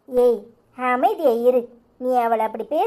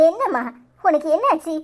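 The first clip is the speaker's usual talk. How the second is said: ordinary talk, nothing marked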